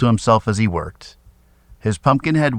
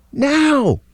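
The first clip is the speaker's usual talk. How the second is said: sharp, much higher call; yelled; 3 dB louder than usual. A narrator's reading. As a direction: snap the signal out. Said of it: "yelled Jess".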